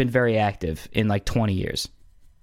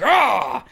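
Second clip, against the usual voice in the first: high, loud mock yell; Pacino outburst yell; 12 semitones higher, 5 dB louder than usual. Mimicking Pacino flipping out in a scene, a sudden yell. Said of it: "Al Pacino, like".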